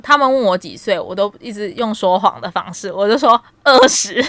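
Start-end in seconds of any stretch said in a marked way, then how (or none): 2.9-4.2 laughing. Retold or imitated. Lying about age, laughing.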